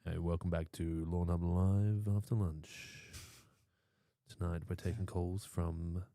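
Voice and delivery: slow, low late-night radio host; sexy radio host voice. Pretend. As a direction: purr it like a smooth DJ. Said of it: "your sexy [name] voice".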